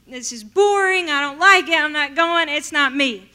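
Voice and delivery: loud, fed-up no to church; stubborn refusal. Retold or imitated. Refusing friends' church invitations, loud and fed up. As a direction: dig in your heels and refuse loudly.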